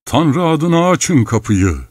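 shouted demand. A narrator's reading. Someone outside demands entry in God's name, shouting. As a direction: draw the demand out high. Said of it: "diye bağırıldı".

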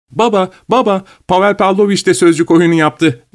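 put-on high girlish calling out to father; excited cry. A narrator's reading. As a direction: call to papa all excited. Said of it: "diye bağırdı".

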